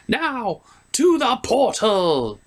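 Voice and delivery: slow, high villain command; sneering panto villain. Acted. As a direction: sweep forward like a stage villain. Second portal line, slow grand.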